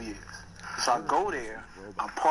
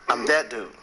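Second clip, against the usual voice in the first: ordinary delivery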